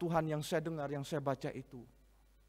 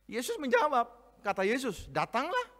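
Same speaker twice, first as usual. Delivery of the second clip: loud, high, fervent Bible reading; scripture read with force; 11 semitones higher, 9 dB louder than usual. Acted. Pastor reading the storm passage aloud, fervent. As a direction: proclaim the passage to the congregation.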